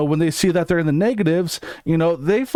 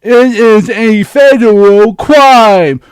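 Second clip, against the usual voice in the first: loud, slow and higher, spelling it out; over-explain it like to a slow child; dumbed-down lecture; 7 semitones higher, 13 dB louder, much slower than usual. Pretend. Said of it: "now that we dumbed it down for you".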